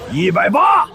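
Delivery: loud, high auction call; shouted counter-bid. Acted. Rival bid called out at auction.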